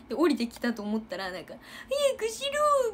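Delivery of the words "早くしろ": high, loud mock shout; mocking imitation. Juniors teasingly parroting the captain's shout, high and loud. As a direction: parrot the captain to tease her. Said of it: "ふざけてね、復唱してくんのね".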